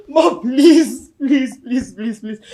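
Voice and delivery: loud sobbed plea, then falling away; tearful pleading. Acted. Child begging mother to get up, tearful. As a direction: beg through tears.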